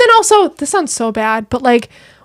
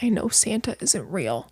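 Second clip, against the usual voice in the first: put-on soft, hushed sad child; sad little voice; 12 dB quieter than usual. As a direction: say it quietly, heartbroken. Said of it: "so sad about it".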